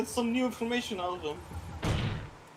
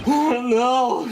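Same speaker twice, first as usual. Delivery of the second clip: shocked gasp; 6 semitones higher, 10 dB louder than usual. Pretend. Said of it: "Massive screams, panic".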